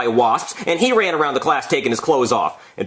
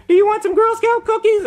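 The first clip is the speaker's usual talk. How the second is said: put-on very high little-girl voice; squeaky mock kid sales pitch; Girl Scouts; 3 dB louder than usual. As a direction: pipe it up like a child selling door to door. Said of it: "they say".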